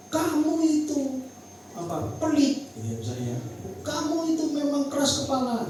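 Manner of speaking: high-pitched blaming voice; accusing. Shown as a demonstration. Spouse blaming partner in a conflict, high and accusing. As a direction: point the blame, pitched well up. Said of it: "jangan ngomong gini".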